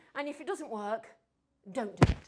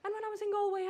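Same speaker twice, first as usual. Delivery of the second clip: normal talking voice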